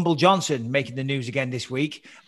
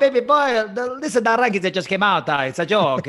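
put-on higher, hammy Italian accent; 5 semitones higher than usual. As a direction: lay the Italian on thick. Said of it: "Your Italian accent is my favorite one now".

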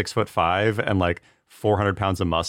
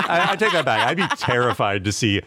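usual speaking voice

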